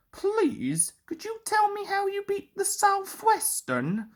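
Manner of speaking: high, soft, eager question; eager young engine. Acted. Duck begs the famous engine, eager and high. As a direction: plead politely and keenly. City of Truro voiced high.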